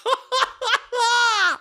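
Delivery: real high burst of laughter; cracking up high. Real, not acted. Laughing at his own story, high cackle.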